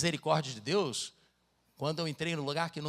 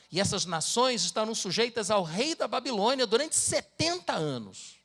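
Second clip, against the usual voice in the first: louder and higher, slowing to a heavy close; solemn scripture reading; 4 semitones higher, 5 dB louder than usual. Acted. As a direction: thunder out God's judgment. Reads scripture aloud.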